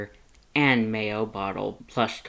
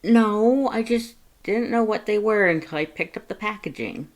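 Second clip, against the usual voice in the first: much higher and louder, embarrassed protest; flustered denial; 11 semitones higher, 6 dB louder than usual. A narrator's reading. Blushing Frisk denies picking the panties, flustered and loud. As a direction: blurt it out, caught red-handed. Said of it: "Frisk blushed".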